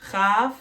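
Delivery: CHAV starting with a throaty 'kh' as in Bach; guttural ch. Shown as a demonstration. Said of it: "when you're doing the chaf".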